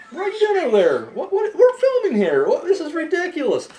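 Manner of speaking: laughing scold. Real, not acted. Host calling out a friend during filming, laughing. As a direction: tease a friend for messing around.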